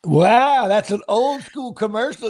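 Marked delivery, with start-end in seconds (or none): 0.0-0.7 a sudden loud burst of surprise; loud surprised wow. Real, not acted. Host hearing an old ad, loud surprised wow. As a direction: blurt it out, caught off guard.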